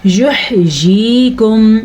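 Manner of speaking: یحییکم with an audible, held throat "h"; clear throat ha. Shown as a demonstration. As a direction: slowly recite یحییکم, stretching "yee". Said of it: "ہا کو واضح کر کے پڑھنا ہے".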